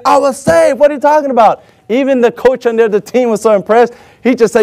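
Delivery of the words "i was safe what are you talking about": high-pitched indignant protest, imitated; mock outraged objection; complaining. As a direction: argue with the ref as the runner. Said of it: "Runner's just complaining".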